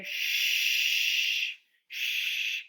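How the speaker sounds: Sh hissed unvoiced; sh sound. Shown as a demonstration. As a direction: stretch out the sh hiss. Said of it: "What digraph do you hear?".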